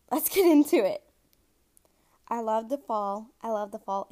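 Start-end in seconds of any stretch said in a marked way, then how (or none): 0.1-1.0 higher and louder, eager kickoff; upbeat launch. Real, not acted. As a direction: fire up the start of the story. Launching the story after throat clear.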